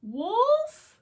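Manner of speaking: put-on high, loud little girl call. Girl calls the wolf's name.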